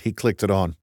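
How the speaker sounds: plain reading voice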